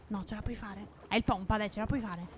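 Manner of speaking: soft, low-volume coaching; hushed encouragement. Real, not acted. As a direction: urge your teammate on under your voice. Coaching partner quietly, enemies nearby.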